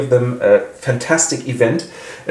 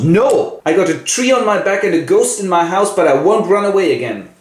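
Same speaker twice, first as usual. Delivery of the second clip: defiant Seth; 8 semitones higher than usual. Acted. Seth answers Denver defiantly.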